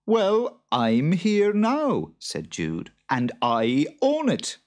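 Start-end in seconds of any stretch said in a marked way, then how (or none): 0.1-2.1 high, loud, slow retort; stubborn farmer raising his voice; defiant farmer. A narrator's reading. Farmer quarrels back. 3.1-4.6 louder, slow, firm retort; stubborn farmer; defiant farmer. A narrator's reading. Farmer quarrels back.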